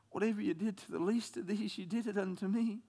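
awed quiet scripture; hushed verse. Acted. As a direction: say it almost under breath.

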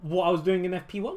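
ordinary talk, nothing marked

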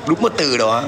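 normal talking voice

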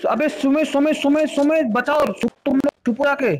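high urgent yell for help; cover me shout. Real, not acted. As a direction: call out to a teammate under fire. Under fire, calling teammate for help.

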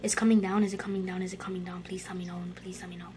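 low muttered plea fading to a whisper; nervous whispered begging; anxious pleading. Real, not acted. Fearfully watching something descend.

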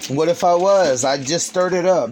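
loud, high, defensive retort; defensive shouting. Real, not acted. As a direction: snap back loudly at her. Argument with partner over food.

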